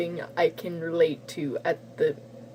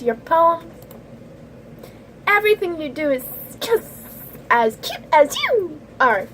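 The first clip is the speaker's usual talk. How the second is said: squealing gush; 6 semitones higher than usual. Acted. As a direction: gush in a high cutesy character voice. Squealing character gushes over cute poems.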